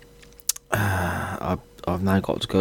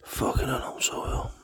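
weak whisper; 3 semitones lower, 9 dB quieter than usual. Real, not acted. Ill, weak, short of breath.

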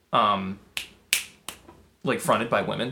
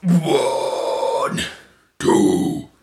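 death metal growl demo; much slower than usual. Shown as a demonstration. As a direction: growl the count like a metal singer. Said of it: "Don't go up".

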